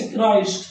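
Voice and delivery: क्राइस्ट said "kraist", long ai, not krist; correct pronunciation. Shown as a demonstration. Teacher correcting krist to kraist for the students. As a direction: stretch the ai in क्राइस्ट. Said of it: "क्रिस्ट नहीं पढ़ोगे आप".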